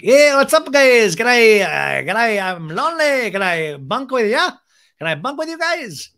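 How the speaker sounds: loud, high, whooping tipsy greeting; playing tipsy. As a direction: pretend drunk and lonely, calling out loudly. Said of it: "I'm tipsy".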